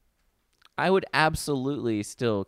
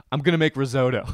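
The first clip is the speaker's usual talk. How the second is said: louder, higher mock-confident contestant; Chopped contestant; 4 semitones higher, 7 dB louder than usual. Contestant with fifty seconds left, comically determined. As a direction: announce it like an over-ambitious contestant, laughing. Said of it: "one contestant says".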